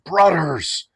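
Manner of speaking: high, imitated call to the brothers; Igor's rallying cry. Asking the group about Igor's greeting, imitated rallying cry. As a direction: put on a friend's accented rallying shout. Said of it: "when Igor stands up and goes".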